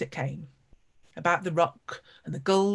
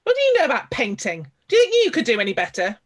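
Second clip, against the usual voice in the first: louder and higher, scornful challenge; indignant retort; 5 semitones higher, 8 dB louder, faster than usual. Acted. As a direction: throw the challenge back at her, offended. Artist bristles at Maggie.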